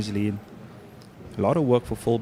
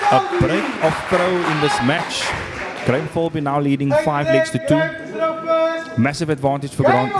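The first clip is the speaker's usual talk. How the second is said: excited commentary; 3 dB louder than usual. Real, not acted. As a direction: shout it out as the leg is won. Commentator as the break of throw lands.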